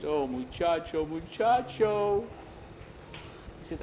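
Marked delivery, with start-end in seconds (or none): 0.0-2.3 put-on high, slow, tearful old woman's voice; a grandmother breaking down over the boy; lightly voiced old woman weeping, drawn-out name. A narrator's reading. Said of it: "The grandmother burst into tears".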